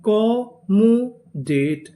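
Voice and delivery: very slow, each syllable drawn out; slow spelled-out build. Shown as a demonstration. Teacher stretching the new word for students. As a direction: sound it out piece by piece. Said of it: "पूरी meaning क्या बनी?".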